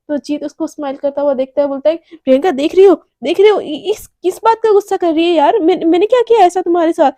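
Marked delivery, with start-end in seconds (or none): 2.2-7.1 louder, higher and quicker, acting wounded innocence; a raised, hurt why-me outburst; indignant protest. Jeet protesting to Priyanka that he did nothing wrong, raised voice.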